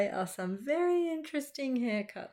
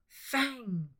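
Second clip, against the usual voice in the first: high, hammed-up bellow; a mock bellow; 6 semitones higher than usual. A narrator's reading. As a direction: bellow the dog's name. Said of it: "bellowed Bungo".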